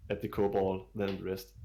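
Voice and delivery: normal talking voice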